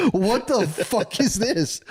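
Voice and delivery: high, drawn-out retold shock; appalled disbelief. Retold shock at decapitated dogs.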